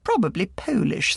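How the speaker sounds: ordinary delivery, nothing marked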